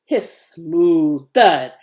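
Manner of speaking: whispered hiss, moo, heavy thud; voiced hiss moo thud. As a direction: sound out the machine noises. Slaughterhouse noises voiced again.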